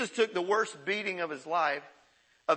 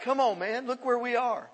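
usual speaking voice

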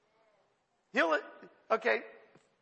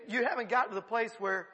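normal talking voice

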